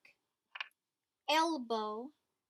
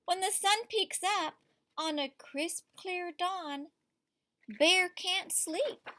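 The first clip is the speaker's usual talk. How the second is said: lively read-aloud to a class; story reading to children; 3 semitones higher, slower than usual. A narrator's reading. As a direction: read it like a picture book at story time. Teacher reads picture book aloud.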